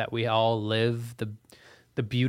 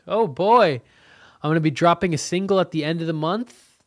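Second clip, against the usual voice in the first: louder, higher, fast hype; excited; 5 semitones higher, 6 dB louder than usual. Real, not acted. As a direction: announce it bursting with energy. Announcing new music single.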